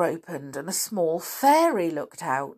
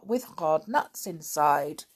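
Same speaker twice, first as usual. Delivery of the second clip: plain reading voice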